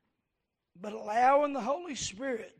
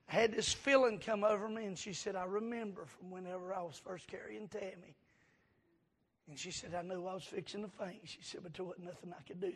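much softer, lower retelling of mother's words; hushed retelling; 4 semitones lower, 8 dB quieter, faster than usual. Retold or imitated. Preacher retelling mother's fainting spell, hushed and low. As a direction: let the quoted words sink very quiet.

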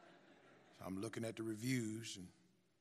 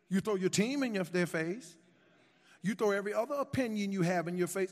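fiery preaching; 6 semitones higher, 9 dB louder than usual. Acted. Preacher rebuking timid believers.

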